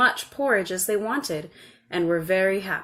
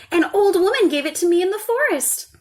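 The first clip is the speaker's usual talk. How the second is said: put-on high, bright-eyed child explaining; eager little girl; 11 semitones higher, 7 dB louder than usual. A narrator's reading. Little girl showing her mother the pot, high and eager. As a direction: show it off proudly.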